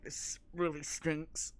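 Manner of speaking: slow, disgusted complaint through a covered mouth; disgusted girl. Retold or imitated. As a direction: say it like the stink is everywhere. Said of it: "covers her mouth 'cause she's like".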